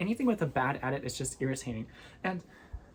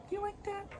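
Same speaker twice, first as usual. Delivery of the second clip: very high, soft coo; pet-talk voice; 7 dB quieter than usual. Real, not acted. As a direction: ask a kitty in a tiny voice. Cooing question to the cat.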